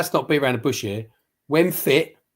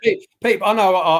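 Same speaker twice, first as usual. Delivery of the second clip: higher, louder call to grab the floor; raised voice cutting into the conversation; urgent cut-in; 5 semitones higher, 6 dB louder than usual. Real, not acted. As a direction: break in loudly over the other man. Cutting in on co-host.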